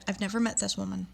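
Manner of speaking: usual speaking voice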